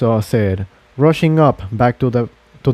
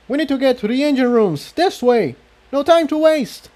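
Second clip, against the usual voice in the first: fast, high, hurried commands; urgent orders; 13 semitones higher than usual. Acted. Jigsaw urging the mares toward the engine rooms. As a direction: hurry them along, no time.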